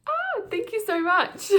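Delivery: high, slow squeal of thanks; delighted high-pitched thank you; giddy thanks. Real, not acted. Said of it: "I'm getting all giddy".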